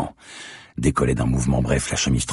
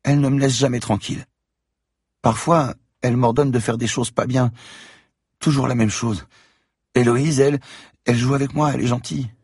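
put-on high child voice, telling a secret; little girl voice; 13 semitones higher, 4 dB louder than usual. A narrator's reading. Girl tells Franck about the voices, high child voice. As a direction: voice a scared child, high-pitched.

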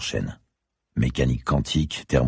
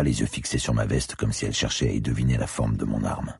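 usual speaking voice

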